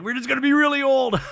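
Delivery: loud, high mock exclamation; hammed-up excited shout. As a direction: belt it out like a huge punchline. Retelling Kyle's joke.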